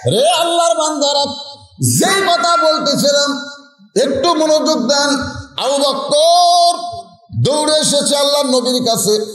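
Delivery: impassioned high-pitched preacher; preaching at full force. Real, not acted. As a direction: call out high, stretching key words. Preacher calls the congregation to attention.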